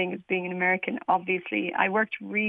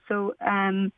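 ordinary talk

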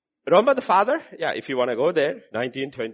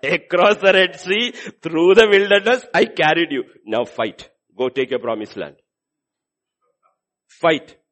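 rally them to fight, booming; commanding God; 2 semitones higher, 9 dB louder than usual. Acted. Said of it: "God says".

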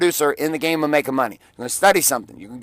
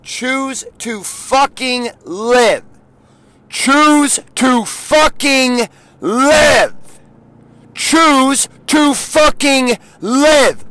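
slow, high, loud pleading shout; screaming; 8 semitones higher, 6 dB louder, much slower than usual. Retold or imitated. Screaming at the woman stuck in chaos, slow furious shout. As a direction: bellow each word to shake her awake. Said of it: "I was screaming at her".

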